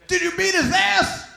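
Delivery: high loud outraged shout; angry dad. Retold or imitated. Dad hears his son was insulted and shouts high and loud. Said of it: "He goes".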